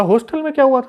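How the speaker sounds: high-pitched echo of the question. Retold or imitated. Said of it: "बोला".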